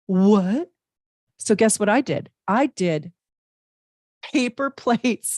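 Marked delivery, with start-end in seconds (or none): 0.1-0.7 surprised. Retold or imitated. Shocked reply to daughter. 4.2-5.4 laughing. Real, not acted. Laughs at own story.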